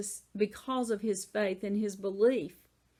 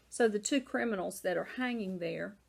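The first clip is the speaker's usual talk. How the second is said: ordinary talk, nothing marked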